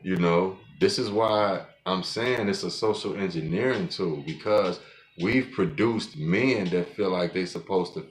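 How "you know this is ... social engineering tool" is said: normal talking voice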